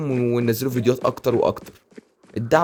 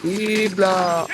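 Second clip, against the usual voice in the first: drawn-out high; 6 semitones higher, much slower than usual. Real, not acted. Gamer repeats the reaction stretched.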